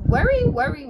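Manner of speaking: sing-song the worry like a fussing grandma; grandmother's voice. Acted. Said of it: "said her grandmother".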